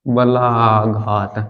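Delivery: बलाघात low and very stretched; slow dictation. Shown as a demonstration. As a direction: draw out each syllable of बलाघात, softly. Term dictated slowly for class. (बलाघात धीरे-धीरे खींचकर)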